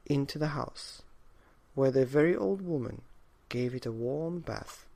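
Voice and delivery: plain reading voice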